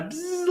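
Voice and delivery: playful imitated insect whine; mosquito buzz.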